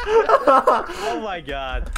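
drawn-out laughing oh my god. Real, not acted. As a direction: laugh through the words, slow. Cracking up at Zerber mixup.

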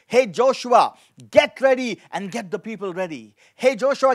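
usual speaking voice

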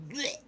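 high retching noise; mock retch; gagging blech. Real, not acted. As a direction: gag like it's disgusting. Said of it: "I'm gagging".

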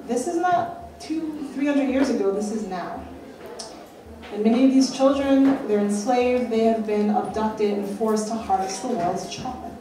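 ordinary talk, nothing marked

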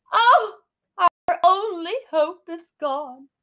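high, loud, wailing mother; crying out. Acted. Mother learns the cow is gone, wailing. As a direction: wail over the lost hope. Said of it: "she cried".

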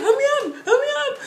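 high, loud squeal through laughter; frantic plea. Retold or imitated. Fan sitting in the grass, laughing frantic plea. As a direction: re-enact the urgent squeal, half laughing.

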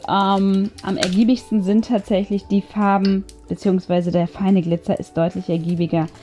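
normal talking voice

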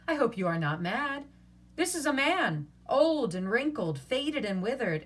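playful reproach; mock scolding. A narrator's reading. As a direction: scold her in jest. Petruchio feigning rebuke.

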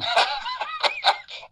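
bright burst of real laughter. Real, not acted. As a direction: break into a genuine laugh. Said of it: "I can hear the smile in your voice".

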